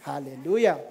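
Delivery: ordinary talk, nothing marked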